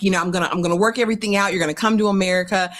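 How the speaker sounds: fast eager mock pledge; rushed mock promise. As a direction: gabble the promises. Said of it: "He's like".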